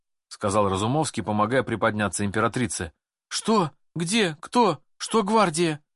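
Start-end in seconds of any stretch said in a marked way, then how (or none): 3.3-5.8 frightened. A narrator's reading. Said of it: "Все страхи Елизаветы накрыли её с головой".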